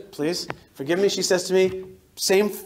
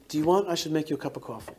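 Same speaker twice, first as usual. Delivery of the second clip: soft unvoiced aside; 2 semitones lower, 7 dB quieter, faster than usual. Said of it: "he says to me in Russian".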